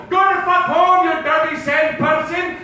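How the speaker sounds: loud, high bigoted yell; yelling in an accent. Acted. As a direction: holler it as a slow, drawn-out insult. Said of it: "when you yell at a word at a person in an accent".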